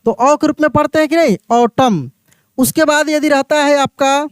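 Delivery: ordinary talk, nothing marked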